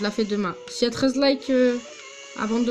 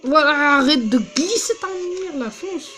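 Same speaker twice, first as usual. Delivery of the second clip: raging gamer; 4 semitones higher than usual. Real, not acted. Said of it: "je rage".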